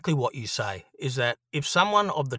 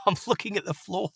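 ordinary talk, nothing marked

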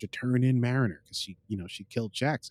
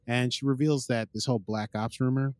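usual speaking voice

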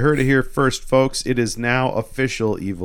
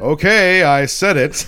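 loud, high comic outburst; mock defiant shout; 6 semitones higher, 7 dB louder than usual. Ash bluffing that the spell is said, mock defiant shout.

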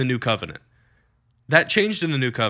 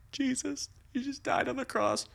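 high, much softer mock whimper; 10 semitones higher, 12 dB quieter than usual. Preacher mocking mournful Christians, high hushed whimper. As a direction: mimic a mournful believer, small and hushed. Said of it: "That's how they live their life".

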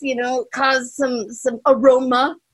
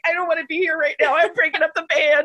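retold crying; 6 semitones higher, 3 dB louder than usual. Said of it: "I'm, like, crying".

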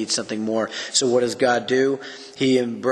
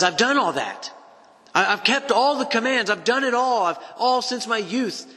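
acted insistence, voice pitched way up; high-pitched protest; 11 semitones higher than usual. Rich young ruler insists to Jesus he kept commands, high-pitched protest. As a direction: protest your innocence in a mock high voice.

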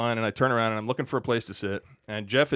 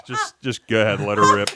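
laughing permission; 6 dB louder than usual. Retold or imitated.

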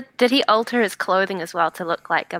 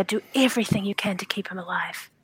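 whisper; 7 dB quieter than usual. Acted. Whispered plea about Nundro.

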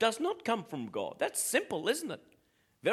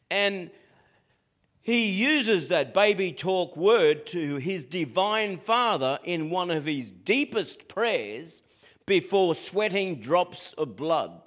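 normal talking voice

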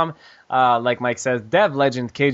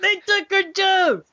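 much higher, slow, hammed-up cry; high excited exclamation; slower than usual. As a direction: wail it high like a cartoon. Exaggerated outburst.

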